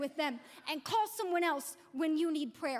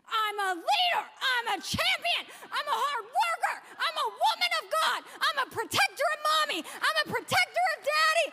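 higher and louder, declared with full force; like you mean it; 10 semitones higher, 5 dB louder than usual. Retold or imitated. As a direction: shout each line like a proud champion. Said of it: "say it like you mean it".

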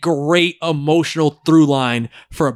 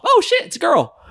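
surprised; 10 semitones higher than usual. Retold or imitated. Imitated viewer surprise.

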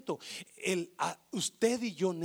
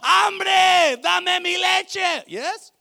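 high, loud, drawn-out demanding cry; mock baby shouting for milk; hungry child yelling; 13 semitones higher, 18 dB louder, much slower than usual. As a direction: scream for milk like a tiny tyrant. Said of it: "comenzaban a gritar".

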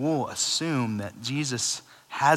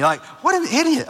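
much higher, loud fed-up outburst; exasperated; 6 dB louder than usual. Real, not acted. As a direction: blurt it in disbelief. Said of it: "It just frustrates me".

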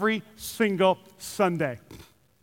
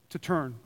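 "to turn." dropped low and soft; the answer given gently, almost under the breath; soft key word; 7 semitones lower, 10 dB quieter than usual. Shown as a demonstration. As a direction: let "turn" land quietly and low. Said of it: "Repent means simply".

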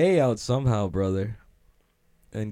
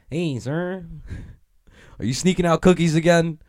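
higher, louder mock little elf voice; imitating elf; 7 semitones higher, 5 dB louder than usual. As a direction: play a tiny elf scolding the guard. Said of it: "She's like".

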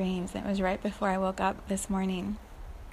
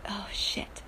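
whisper; 8 dB quieter than usual. Retold or imitated. Realizing in dream she is Viv.

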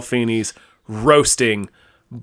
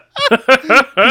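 high laugh; 11 semitones higher, 5 dB louder than usual. Real, not acted. Player laughing at own joke.

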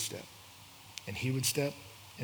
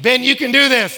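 loud, high-pitched rallying cry; urging shout; 10 semitones higher, 16 dB louder than usual. Retold or imitated. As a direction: shout encouragement at a struggling friend. Urging a collapsed friend on.

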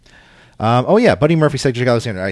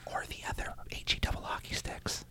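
hushed whisper of a taboo word; 17 dB quieter than usual. Real, not acted. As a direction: whisper it as if it's naughty. Joking hushed aside.

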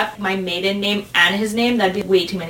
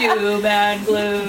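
doom voiceover; much slower than usual. Pretend. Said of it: "that's how it would sound like".

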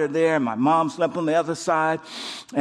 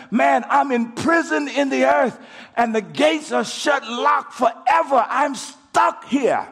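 anguished cry; 6 semitones higher, slower than usual. Retold or imitated. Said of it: "like he says".

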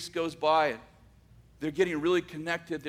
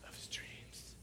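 whispered, barely voiced; dreamy whisper; 20 dB quieter, slower than usual. A narrator's reading. Narrator describing the woman of his dreams, in a whisper. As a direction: breathe it like a secret.

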